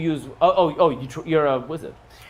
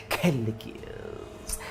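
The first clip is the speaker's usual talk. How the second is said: normal talking voice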